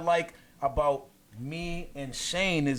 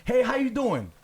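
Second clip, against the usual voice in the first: higher-pitched call to passers-by; friendly greeting; 6 semitones higher, 4 dB louder than usual. Retold or imitated. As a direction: hail a stranger brightly, pitched up. Greeting strangers who ignore him.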